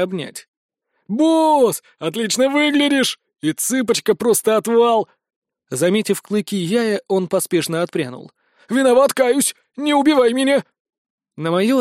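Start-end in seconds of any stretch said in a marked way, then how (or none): 1.1-5.1 cheery bandit. Acted. Bandit greets boss cheerfully. 8.7-10.6 frightened plea. Acted. Bandit begs in fright.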